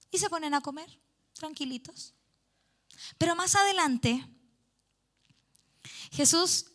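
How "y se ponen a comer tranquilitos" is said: fading to hushed. A narrator's reading. Calm breakfast scene described.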